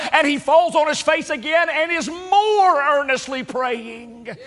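preacher voicing Jesus refusing comfort; fervent preaching. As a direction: build the sermon to a climax. Preacher builds to climax.

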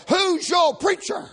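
high, loud demanding question; hammed-up nosy hairdresser, shouted. Hairdresser demanding the customer name his preacher, shouted. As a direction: pounce on the answer.

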